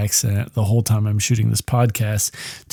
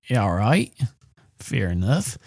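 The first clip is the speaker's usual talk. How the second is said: low-key, softer imitated Australian; Australian accent; 3 semitones lower, 6 dB quieter than usual. Woman agreeing to enter dark alley, imitated Australian accent. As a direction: quietly put on the Australian accent. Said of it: "she's using her, uh, Australian accent".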